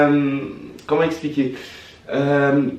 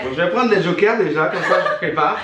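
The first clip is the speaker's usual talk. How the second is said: laughing; 5 semitones higher than usual. Real, not acted. Guest jokes about the jokers.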